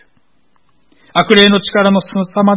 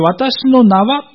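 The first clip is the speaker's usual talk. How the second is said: 私の名は drawn out slowly and high; slow raised emphasis on 私の名は; stress on 私の名は; 7 semitones higher, much slower than usual. Shown as a demonstration. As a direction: lean hard on 私の名は. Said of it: "と言っておきながら".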